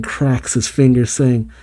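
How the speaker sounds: ordinary delivery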